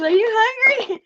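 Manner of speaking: imitating a school friend offering food; mimicking the friend. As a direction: ask it brightly, far up in pitch. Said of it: "She goes".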